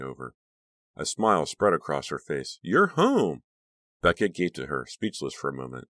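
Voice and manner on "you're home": high, louder gushing cry; sugary delight. A narrator's reading. Friend caught in bedroom gushes a welcome. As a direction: overdo the welcome.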